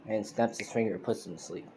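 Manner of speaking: plain reading voice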